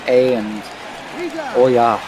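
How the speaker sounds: a light Yooper "oh ya"; imitating Yooper accent. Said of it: "every time I go up there, I'm saying".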